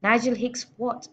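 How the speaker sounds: loud demanding bellow. A narrator's reading. Trunchbull bellowing at Nigel to add her title. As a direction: roar it down at the boy. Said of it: "the Trunchbull bellowed".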